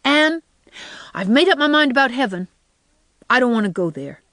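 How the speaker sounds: put-on high, firm small-boy announcement; Davy, decided. A narrator's reading. Boy announces his decision about heaven, decidedly. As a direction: declare it with your mind made up. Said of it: "announced decidedly".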